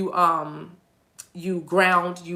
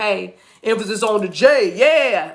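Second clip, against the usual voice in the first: loud hollered heckle; loud, emphatic mock shout; masculines calling out; 2 semitones higher, 7 dB louder, slower than usual. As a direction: holler it like someone butting in from the crowd. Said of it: "They talking about".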